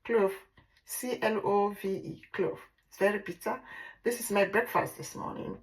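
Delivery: ordinary delivery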